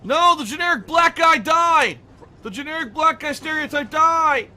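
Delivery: loud, high outraged yell at the screen; outraged shout. Real, not acted. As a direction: shout it in disbelief. Shouting at game death.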